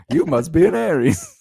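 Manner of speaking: normal talking voice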